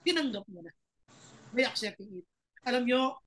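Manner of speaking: ordinary talk, nothing marked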